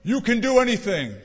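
high, loud urgent petition to God; fervent pleading prayer. Retold or imitated. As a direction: beg the Lord with rising fervor. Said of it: "I imagine maybe they were praying something like".

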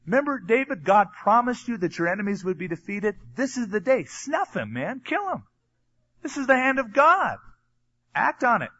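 high, pressing voice of the men egging him on; eager urging. Retold or imitated. David's men urging him to kill Saul, high and eager. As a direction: push David to strike, urgent and high.

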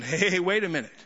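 normal talking voice

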